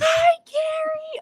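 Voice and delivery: high, loud and slow, stretching the hi; excited greeting. Real, not acted. Host greeting a viewer from Australia with an excited squeal. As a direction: squeal hello to a friend you miss.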